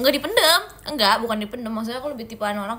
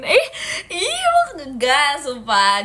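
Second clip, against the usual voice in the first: emphatic protest; 4 semitones higher, 7 dB louder, much slower than usual. Real, not acted. Protesting a viewer's remark.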